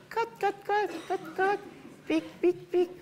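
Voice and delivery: very high squeaky hen clucks; clucking chicken. Acted.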